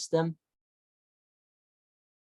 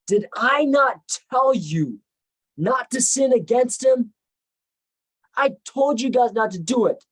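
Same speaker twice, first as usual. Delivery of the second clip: reproachful; 8 semitones higher, 5 dB louder than usual. Retold or imitated. Reuben scolds his brothers.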